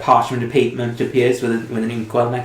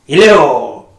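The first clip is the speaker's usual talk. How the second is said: calling out; 2 semitones higher, 7 dB louder than usual. Acted. Calling into intercom.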